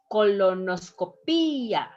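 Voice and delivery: "colonoscopía" with a strong, long PÍ, falling on the final a; "colonoscopía" as co-lo-nos-co-PÍ-a, emphatic Í; stress on accented vowel. Shown as a demonstration. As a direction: lean on the Í in "colonoscopía". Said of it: "where your voice has to be strong".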